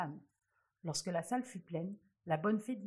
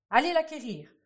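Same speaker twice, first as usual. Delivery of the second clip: angry command; 7 semitones higher, 11 dB louder than usual. A narrator's reading. King orders his guards.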